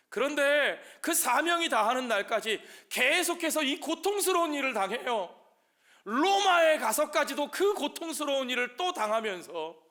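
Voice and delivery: high, loud, emphatic lament; impassioned preaching. Real, not acted. Pastor telling the congregation of continued suffering, impassioned and loud. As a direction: drive home the suffering at full force.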